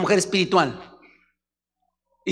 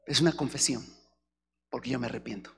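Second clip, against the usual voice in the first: hushed confession; 6 semitones lower, 8 dB quieter than usual. Acted. Acted quiet confession.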